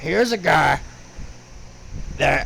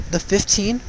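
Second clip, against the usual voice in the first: normal talking voice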